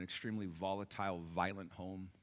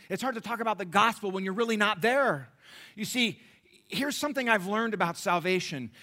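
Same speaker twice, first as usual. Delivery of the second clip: louder and higher, building to a shout; preaching at full force; 9 semitones higher, 13 dB louder than usual. Acted. Preacher driving home the point to his congregation, shouting. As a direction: preach it, climbing in pitch and volume.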